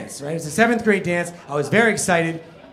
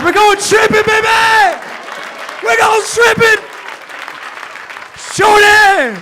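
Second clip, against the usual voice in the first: high, loud victorious yell; triumphant shout; 13 semitones higher, 8 dB louder, slower than usual. Retold or imitated. Comic shoving Brian into a locker, yelling in triumph. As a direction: bellow it like the bully now. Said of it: "I was like".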